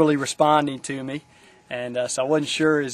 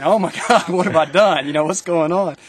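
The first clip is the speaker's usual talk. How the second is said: loud, higher, half-laughing panic; retold alarm; 4 semitones higher, 8 dB louder than usual. Counselor reliving seeing the teacher in tears, louder alarmed. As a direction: let the old worry burst out with a laugh. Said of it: "And I'm thinking".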